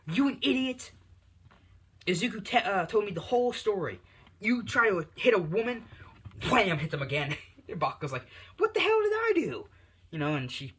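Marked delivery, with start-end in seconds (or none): 0.1-5.8 high, loud shrieking scold; furious mom yelling. Acted. Bakugo's mom screaming at her son for hitting a woman, furious. 6.5-6.8 shouted wham as a slap; loud slap sound effect. Acted. Narrator shouting the second slap, loud. 8.6-9.7 very high, loud indignant shriek; outraged yelp. Acted. Bakugo shrieking at his mom after the slap, outraged.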